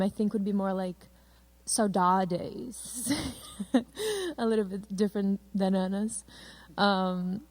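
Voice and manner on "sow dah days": "saudades" in an American accent, sow-DAH-days; Americanized "saudades" with hard d, ending in "days"; American take. Shown as a demonstration. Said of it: "my American take on the name".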